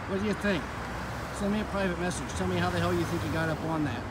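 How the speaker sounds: normal talking voice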